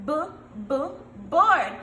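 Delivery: "B, b, board." with a loud board; sound out b. Shown as a demonstration. Teacher sounding out a word for pupils. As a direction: tap the b twice then say the word. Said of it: "Very good".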